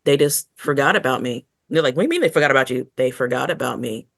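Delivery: ordinary delivery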